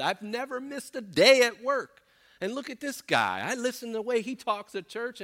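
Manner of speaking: high, indignant mock griping; exaggerated self-pitying complaint. Envious churchgoer griping about another man's cars and boats, exaggerated indignation. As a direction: complain with building outrage, pitch climbing. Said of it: "exaggeration to prove it's hyperbole".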